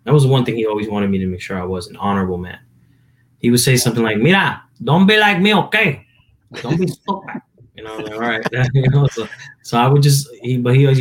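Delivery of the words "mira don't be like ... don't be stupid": put-on high-pitched Puerto Rican dad accent; dad's Spanish accent. Son mimics his dad laying down advice, thick Spanish accent. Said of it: "I don't know why he has an accent".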